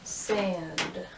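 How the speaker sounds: usual speaking voice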